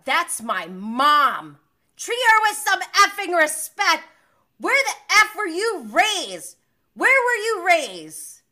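loud, slow, high imitated yelling; retold furious scolding. Host as Katie defending her mom to Raquel, furious scolding. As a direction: act out a daughter yelling in her mother's defence.

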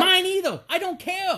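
high, loud, fed-up comeback; mock retort. Dad answering his daughter, loud and fed up. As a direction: snap back like a worn-out parent.

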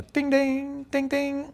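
high sung bell ting; door chime. Acted. Entering the worm shop, a high chiming ting.

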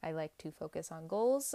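ordinary talk

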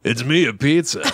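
laid-back stoner pizza. Retold or imitated. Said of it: "sound like a stoner Bill Maher type".

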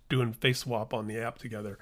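ordinary delivery

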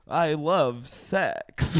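put-on very drawn-out voice of an old bag. Mocking Sex and the City women, very slow and drawn-out. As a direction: stretch every word as a lusty old bag. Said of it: "old bags being like".

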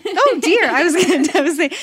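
high laughing exclamation. Real, not acted. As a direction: laugh through the words. Laughing at the hiccups.

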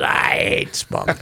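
gruff deadpan. Retold or imitated. Quoting Lou Grant.

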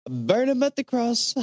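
very high put-on cry, held at one pitch; mock condemning cry. Podcaster playing the king condemning the Templars, high mock cry. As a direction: cry it out high, like a hammed-up accuser.

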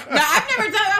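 high, loud laughing denial. Real, not acted. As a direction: laugh it out while protesting. Laughs at the rubber band scene.

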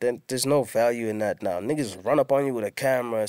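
ordinary talk, nothing marked

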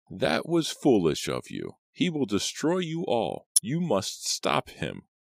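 slow, croaky crone with swooping pitch; croaking warning. A narrator's reading. Old Aggie warning Simon of his destruction, urgent croak. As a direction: croak a dire warning, slowly. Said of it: "croaked old Aggie".

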